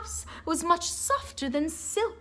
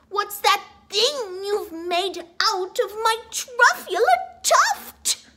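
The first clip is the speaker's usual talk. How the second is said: high, louder indignant shout rising to tuft; 7 semitones higher, 3 dB louder than usual. Acted. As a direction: puff up and shout it at him. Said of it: "as he shouted and puffed".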